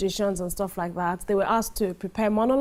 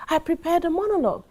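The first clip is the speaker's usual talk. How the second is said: higher-pitched, assured answer; confident; 6 semitones higher than usual. Shown as a demonstration. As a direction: answer the judges with a sure yes. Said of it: "please be confident and say".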